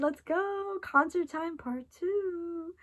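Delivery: high, drawn-out hype call; hyped cheer. Retold or imitated. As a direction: stretch it out like a pumped-up concert cry. Hyped for her song.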